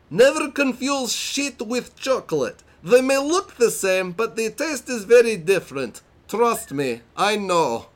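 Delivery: high-pitched mock Italian accent; over-the-top Borat-esque Italian accent; hammy Italian-Borat accent. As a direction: read it in a ridiculous Italian accent going into Borat. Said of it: "I'm gonna read it in the best accent that I can".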